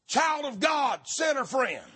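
ordinary talk

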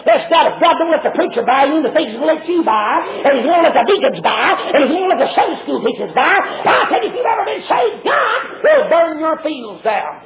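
loud, climbing revival cadence; fiery pulpit climax, last words stretched; full-force preaching. Real, not acted. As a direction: hammer the warning up to a high shout.

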